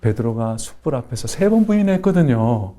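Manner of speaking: rising, building sermon cadence; fervent preaching. Acted. Pastor preaching to the congregation, building fervour. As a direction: preach it to the congregation with growing fire.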